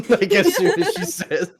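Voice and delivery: laughing through the words. Real, not acted. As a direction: crack up mid-sentence. Laughing while retelling.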